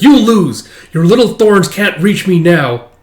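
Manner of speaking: a hammed-up victorious shout; triumphant yell. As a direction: crow over her as if winning.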